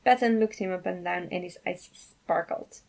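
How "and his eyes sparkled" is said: plain reading voice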